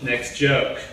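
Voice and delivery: normal talking voice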